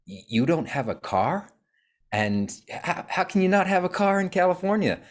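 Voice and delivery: mock a Californian who can't believe you have no car, in a Californian accent; bad Californian accent. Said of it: "That's my bad, uh, Californian accent".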